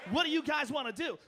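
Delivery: high, loud DJ patter; morning radio DJ voice. Retold or imitated. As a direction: hype it like a morning radio host. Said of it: "talks like morning radio guy".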